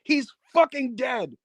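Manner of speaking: high-pitched incredulous taunt on the mic; Mister Pogo. Retold or imitated. Wrestler berating Onita for checking on a beaten man, high mock taunt. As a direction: imitate a wrestler's scornful mic rant, pitched well up. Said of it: "Mister Pogo was saying on the mic to Onita".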